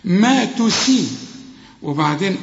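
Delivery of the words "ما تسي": ما تسي slow and light; light s drill. Shown as a demonstration. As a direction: stretch ما تسي, thin light س. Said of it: "أكثر رقة إذا كانت مكسورة".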